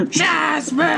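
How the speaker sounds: high mock yell of a name; imitating Aladdin yelling. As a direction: call up to the tower, hammed-up. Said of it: "Aladdin yelling to the top of the tower".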